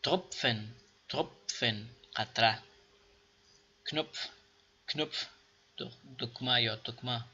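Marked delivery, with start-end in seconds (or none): 0.0-1.9 تروپفن said TROP-FEN with pf; teacher drill; pf sound demo. Shown as a demonstration. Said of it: "صدای مشترک بین پ و ف". 3.9-5.3 کنوپف said K-NOPF, k sounded, pf end; teacher drill; pf sound demo. Shown as a demonstration. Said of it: "صدای مشترک بین پ و ف".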